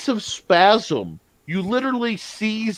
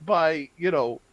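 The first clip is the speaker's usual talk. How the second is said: ordinary talk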